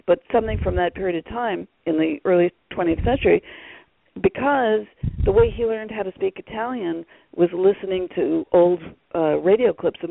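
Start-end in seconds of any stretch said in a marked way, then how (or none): none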